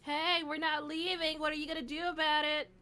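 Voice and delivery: loud, high-pitched challenge into the dungeon; calling out. Acted. Player's character challenges unseen foes in the room, calling out. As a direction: call out loudly to whoever might be listening. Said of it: "I'm gonna just kinda call out".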